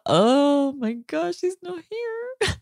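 reliving the moment the tears came; voice breaking into tears. Retold or imitated. To her husband, recalling how she broke down crying. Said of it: "and you started crying".

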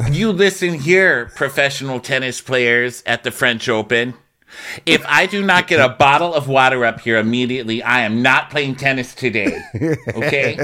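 hammed-up ultimatum; mock indignant diva. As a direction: play a demanding tennis star laying down the law. Mock tennis diva demanding water.

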